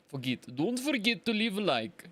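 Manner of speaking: put-on playful Russian accent. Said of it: "That wasn't a bad Russian accent".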